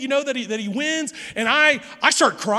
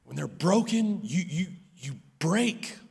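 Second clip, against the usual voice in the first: hushed reflective preaching; 6 semitones lower, 9 dB quieter than usual. Acted. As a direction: let each line land quietly. Slows to a hushed reflective passage.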